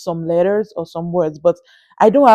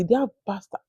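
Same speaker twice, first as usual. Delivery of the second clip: hushed daring word; 10 dB quieter than usual. Real, not acted. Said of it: "I didn't know whether I can say the word".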